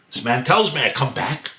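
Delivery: ordinary delivery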